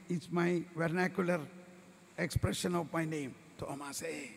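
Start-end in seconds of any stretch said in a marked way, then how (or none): 3.6-4.3 lower, softer loving call of "Thomas, eh?". Acted. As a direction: call him gently like a fond friend. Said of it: "Jesus said very lovingly".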